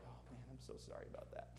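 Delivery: soft, breathy mock apology to the kids; whispered apology. Parent whispering a sheepish sorry to the kids. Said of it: "I'm like".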